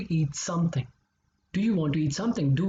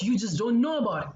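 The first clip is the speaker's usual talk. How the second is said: normal talking voice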